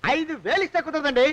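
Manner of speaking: normal talking voice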